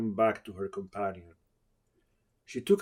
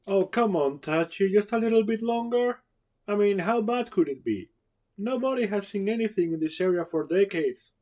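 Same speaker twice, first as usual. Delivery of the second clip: much higher, wheedling plea; Jerry begging Tachi to keep searching, high and wheedling; pleading young woman; 11 semitones higher, 4 dB louder than usual. Acted. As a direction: coax him into staying longer.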